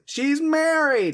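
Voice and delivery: high, drawn-out mock group cry; simultaneous chorus retort. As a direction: imitate a whole group chiming in, high and stretched. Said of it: "all simultaneously".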